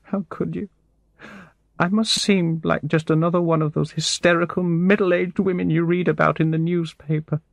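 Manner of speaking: very high, tearful woman's voice; high-pitched, desperate tearful outpouring; distraught crying voice. A narrator's reading. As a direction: act a woman breaking down in tears. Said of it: "She stopped crying".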